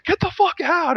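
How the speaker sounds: high-pitched mock scream of an angry brother; retold scream. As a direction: imitate a furious brother screaming. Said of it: "looks at me and screams".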